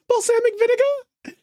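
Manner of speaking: put-on very high New York-accented Elmo, "Balsamic vinegar?" rising. Friend imitating Elmo's New York "Balsamic vinegar?". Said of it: "clearly had a New York accent".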